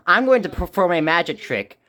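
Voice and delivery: usual speaking voice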